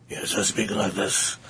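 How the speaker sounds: deep, slow, raspy villain voice; Darth Vader voice. Shown as a demonstration. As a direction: Darth Vader with a smoking habit. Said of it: "Darth Vader with a smoking habit".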